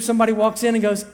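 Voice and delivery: ordinary delivery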